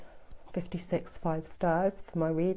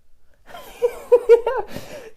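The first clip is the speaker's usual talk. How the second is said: high loud laugh bursting out; burst of laughter; 10 dB louder than usual. Real, not acted. Reader laughs at a viewer's comment about blunders, real laughter. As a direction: let a real laugh break out.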